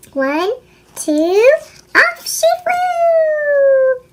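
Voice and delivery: very slow, high soaring line; drawn-out excitement. Acted. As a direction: stretch it like flying. Olive launched over ravine.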